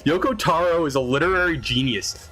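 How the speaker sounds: hammed-up pretentious fan defending; mock nerd voice. Mocking lore defender.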